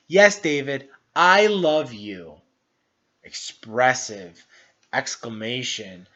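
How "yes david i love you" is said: loud, slow, joyful declaration of love; excited loving mother. Acted. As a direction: beam out the love to your child, stretching the words. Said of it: "she's excited to say that she loves him".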